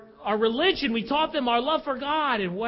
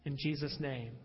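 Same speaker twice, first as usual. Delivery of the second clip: quiet closing prayer, words falling softer; 8 semitones lower, 6 dB quieter than usual. Acted. Pastor closing a prayer before the sermon, quiet and reverent. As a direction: pray it down softly to amen.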